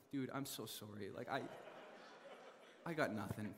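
soft, low, laughing apology; sheepish apology. Retold or imitated. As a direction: mumble sorry with a helpless laugh. Sheepish reply to police officer.

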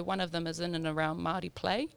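ordinary talk, nothing marked

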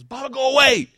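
put-on high, loud little-kid cry; imitated panicked child. Toddler caught red-handed at the water, high mock protest. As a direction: mimic a caught toddler shooing dad away.

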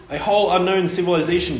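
quick, brightly eager mum; mum, thrilled by the stone heads, declares quickly; eager declaration. A narrator's reading. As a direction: rush it out like a thrilled explorer. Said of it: "declared Oliver's mum".

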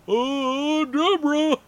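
high, odd comic voice; lightly goofy celebrity voice; Ray Romano impression. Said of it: "kind of a weak impression".

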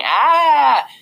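high, loud, held scream; imitated childish shriek; little kid's scream. A narrator's reading. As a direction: shriek like a small boy fleeing. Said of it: "talking about how he had run away from August going".

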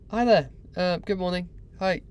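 high, hesitant hellos to catch someone's attention; getting attention. Retold or imitated. Job hunter greets a man on a boat, tentative attention-getting. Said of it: "Just getting his attention".

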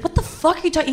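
mock offence at the very suggestion; offended. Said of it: "really offended".

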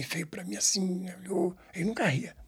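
hushed, breathy whisper. Retold or imitated. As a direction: whisper as if still scared of him. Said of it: "tense retelling of stern man".

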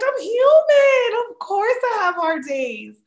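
high, loud, drawn-out mock protest; exclaimed retort. Answering people who ask about hard days, loudly exclaiming. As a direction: cry it out as if it were obvious.

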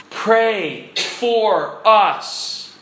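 slow, drawn-out words with pauses; spaced out, solemn appeal; emphatic plea. Real, not acted. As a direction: urge it one weighty word at a time.

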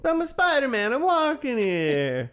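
very high, loud mock Bronx accent; Spider-Man. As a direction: ham up a New York accent, stretching the ending. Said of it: "like, the Bronx accent or whatever".

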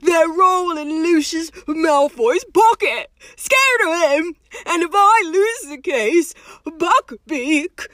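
high, loud, slow lament; sobbing. A narrator's reading. Hagrid to his visitors, fearing for Buckbeak, sobbing. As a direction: wail it in despair, pitched well up. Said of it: "sobbed Hagrid".

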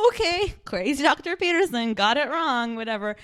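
high, loud scoffing brush-off; dismissive mock quote. Mocking the TV couple's dismissive brush-off. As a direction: wave off the doctor with a scoff.

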